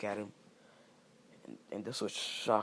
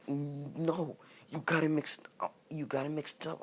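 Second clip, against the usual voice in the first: high, protesting stammer; mock-panicked protest, pitched up; flustered denial; 6 semitones higher, 3 dB louder than usual. Orochimaru denying an accusation to Naruto, flustered. Accused character hurriedly denies it.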